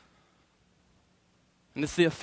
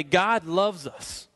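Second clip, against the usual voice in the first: "God loves us." spoken slowly with weight on "God"; slow down and lean on "God"; slow emphasis; much slower than usual. Shown as a demonstration. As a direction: let "God loves us." land, slow and deliberate. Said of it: "Let that sink in".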